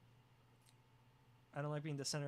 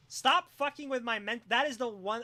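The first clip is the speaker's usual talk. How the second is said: louder, half-laughing protest; loud mock protest; 5 semitones higher, 9 dB louder than usual. Real, not acted. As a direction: shout it at chat in mock outrage. Mock outrage at chat.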